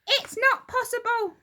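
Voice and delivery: put-on high, loud, upset old wife; Mrs Twit crying out. Old wife cries out to her husband, high and alarmed.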